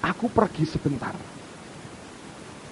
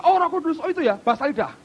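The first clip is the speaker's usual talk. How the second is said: higher, dismissive mock voice of a scoffer; mocking imitation; 11 semitones higher, faster than usual. Preacher mimics churchgoers, mock dismissive. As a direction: toss it off like a know-it-all. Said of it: "mesti berkata".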